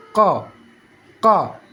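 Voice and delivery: "Ka, ka" each as one knock at the back; single-tap qaf. Shown as a demonstration. Teacher showing one tap, "Ka, ka" knocked out separately. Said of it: "sekali ketuk".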